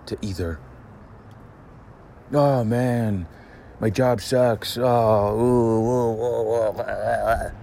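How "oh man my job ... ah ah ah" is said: high, loud moaning complaint; mock whiner. Mocking a man griping about his job, loud and high. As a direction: ham up the sad moaning.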